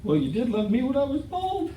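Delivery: high, rising voice as the wife; imitated wife. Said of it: "she stops and she goes".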